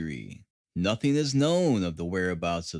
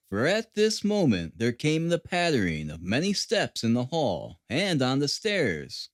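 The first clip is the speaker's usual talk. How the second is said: usual speaking voice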